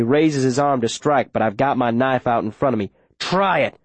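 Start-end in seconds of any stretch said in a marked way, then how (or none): 3.2-3.8 through gritted teeth. A narrator's reading. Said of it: "I say through my teeth".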